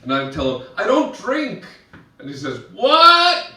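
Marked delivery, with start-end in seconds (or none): 0.8-1.7 high-pitched mock call over music; raised voice. 2.7-3.5 high, loud shouted question; can't hear you. Retold or imitated. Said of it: "is always way too loud".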